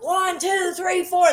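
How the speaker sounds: high, called-out count-in; band count-in. Retold or imitated. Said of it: "and I'm like".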